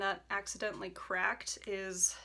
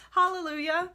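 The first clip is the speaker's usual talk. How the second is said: loud, high hallelujah; triumphant cry; 9 semitones higher, 10 dB louder than usual. Real, not acted. Triumphant exclamation.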